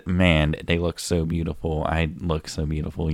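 ordinary delivery

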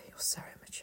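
sighed, whispered put-down; whispered mock disdain. Friend voicing old Legolas to his companions, whispered disdain. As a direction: whisper it under your breath, mock-weary. Acted aside by older Legolas.

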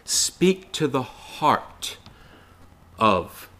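very slow, soft and intimate; slow hushed heartfelt plea; tender, heartfelt. Shown as a demonstration. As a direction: let each word sink in gently. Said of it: "Getting to their heart".

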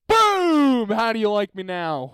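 loud, high, gloating shout; big mock victory cry; triumphant. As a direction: crow in victory. Triumphant exit retold.

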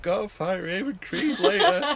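high, loud imitated wail; hammed-up crying complaint; mock crying. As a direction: mimic a tearful protest at full volume. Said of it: "like, sort of cry thing".

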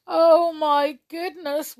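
loud, high, slow wail; miserable wail. A narrator's reading. Jump hearing of the witch, wailing miserably. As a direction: drag it out in misery. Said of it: "wailed Jump in a miserable voice".

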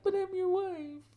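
high, pleading wife voice; upset wife. Retold or imitated. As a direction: whine it like a hurt new bride. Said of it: "crying and carrying on".

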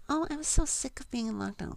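mock them with a high whine; whiny mock complainer. Podcaster mocking people sick of lockdown, high whiny voice. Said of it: "people are gonna be like".

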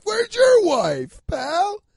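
loud, high, drawn-out heckle; mock angry retort. Firing back at Freddie's critic, mock angry shout. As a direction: yell it back at the busybody.

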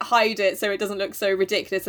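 ordinary delivery

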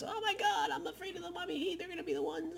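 high, soft mock-scared whine; a put-on scared falsetto mocking a rival; mocking fearful falsetto. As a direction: squeak it like a frightened fan. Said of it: "how much he says".

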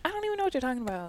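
customer service voice. Real, not acted. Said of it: "You kinda did it right there".

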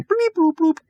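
squeaky high computer chirps; high bleep bloop computer sounds. Acted. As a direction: chirp it like a cartoon console beeping. Hailing sound.

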